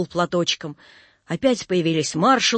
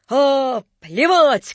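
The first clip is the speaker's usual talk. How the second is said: loud, higher, devil-may-care cry; dashing shout; 5 semitones higher, 5 dB louder, much slower than usual. A narrator's reading. Napoleon shrugs off danger, dashing shout. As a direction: throw it out with bravado. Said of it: "крикнул он лихо".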